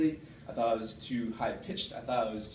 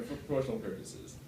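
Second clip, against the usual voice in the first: ordinary talk, nothing marked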